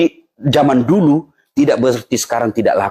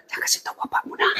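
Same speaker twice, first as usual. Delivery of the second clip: conspiratorial whisper; 10 dB quieter, faster than usual. Retold or imitated. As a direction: whisper it behind the father's back. Mother secretly siding with child. (ibu berbisik diam-diam kepada anak)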